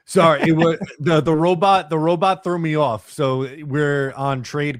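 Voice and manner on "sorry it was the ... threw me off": laughing through the words; giggling apology. Real, not acted. Cracking up at the robot glitch.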